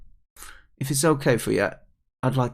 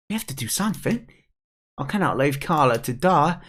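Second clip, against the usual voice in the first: put-on higher, urgent kid voice; pleading young character; 4 semitones higher than usual. Kid begging the pike to save Carla, urgent. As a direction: plead to save your friend, pitched up.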